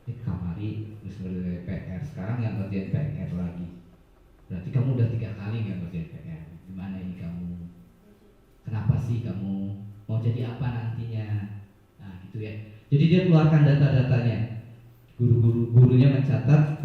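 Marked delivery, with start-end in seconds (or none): 0.0-11.6 softer, lower, listing the pupil's record; monitoring teacher. Acted. Teacher confronts pupil with his homework record, softer and lower. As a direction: corner him calmly with the facts. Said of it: "tapi mengeluarkan data-data".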